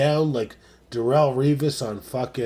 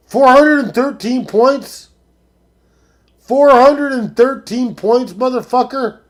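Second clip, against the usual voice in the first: higher and louder, stunned disbelief; can't believe the score he's reading; shouting four hundred and thirteen in disbelief; incredulous outburst; 7 semitones higher, 6 dB louder than usual. Real, not acted. Reacting to a rival's huge score.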